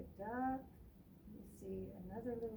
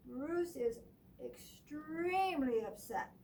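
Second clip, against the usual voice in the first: ordinary delivery, nothing marked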